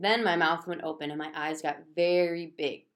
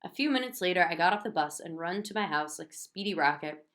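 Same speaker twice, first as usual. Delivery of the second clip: plain reading voice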